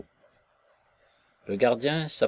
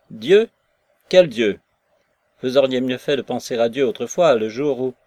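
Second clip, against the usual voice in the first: loud, rising scorn at his talk of God; angry outburst; 3 semitones higher, 8 dB louder than usual. Acted. Maslova, drunk, rounds on Nekhludov: loud angry scorn. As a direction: throw his God back at him, loud.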